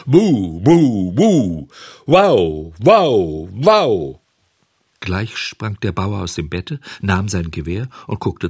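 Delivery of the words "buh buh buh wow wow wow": loud furious dog barks; angry barking. Acted. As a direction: bark like a guard dog. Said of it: "Laut dröhnte sein zorniges Buh". (lautes zorniges Bellen)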